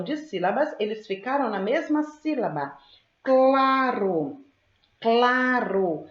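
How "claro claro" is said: "Claro" drawn out, CL hit firmly; C-L cluster emphasized. Shown as a demonstration. Teacher showing the C L cluster, emphatic word demo. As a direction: sound out "claro" with a stretched, clear CL start. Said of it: "Eu tenho o encontro consonantal C L".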